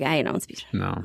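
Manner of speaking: low, deflated admission. Acted. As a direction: drop the pitch right down, conceding defeat. Acts confidence, then deflated self-correction.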